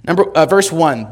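usual speaking voice

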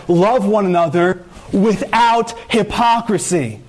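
without hypocrisy slowly weighed; slow, stressed scripture. Shown as a demonstration. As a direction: lean hard on "without". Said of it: "he said".

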